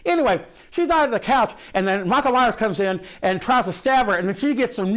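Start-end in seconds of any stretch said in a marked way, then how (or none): none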